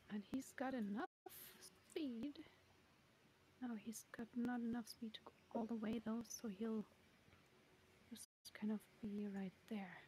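whispered scene narration; hushed game master whisper. A narrator's reading.

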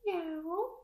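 high, drawn-out kitty meows; imitated cat cries; cat meowing. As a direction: mew like a little cat. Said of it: "It goes".

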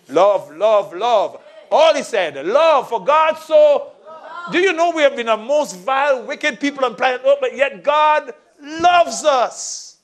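high, loud preaching climax, slowed; preaching at full force. Acted. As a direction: shout it from the pulpit.

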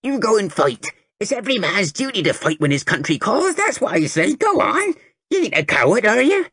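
put-on high croaky toothless old lady; croaking old woman. A narrator's reading. Toothless old lady urging the boy to enlist, croaking. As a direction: croak it like a crone.

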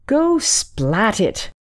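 put-on a girl urging her mom, understated shout; mild shout. A narrator's reading. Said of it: "shouted Susie".

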